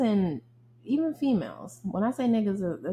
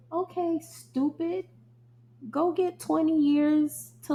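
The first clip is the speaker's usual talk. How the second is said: much higher, scornful telling-off; scornful scolding; 6 semitones higher, 3 dB louder than usual. Real, not acted. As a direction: talk down to the criminal with contempt. Scolding criminals on the news.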